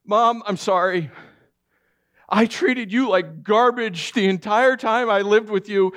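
high, loud pleading confession; tearful apology. Retold or imitated. Son pleading to his mother, high and loud. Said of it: "Patrick said".

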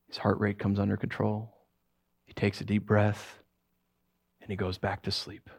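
subdued bedtime-quiet narration; hushed narration. A narrator's reading. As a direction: settle down quietly, almost to a whisper.

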